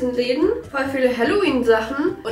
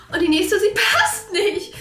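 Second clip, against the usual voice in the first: slow, higher, laughing through it; 6 semitones higher, slower than usual. Real, not acted. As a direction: laugh while saying it. Laughs at her ill-fitting braces.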